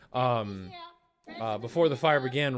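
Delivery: usual speaking voice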